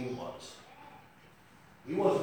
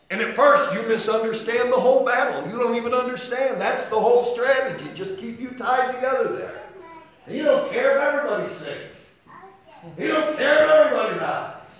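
loud, higher, driving sermon cry; a full-throated preacher's build; preaching at full force; 8 semitones higher, 9 dB louder than usual. Acted. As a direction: thunder it out from the pulpit.

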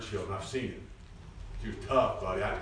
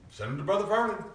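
normal talking voice

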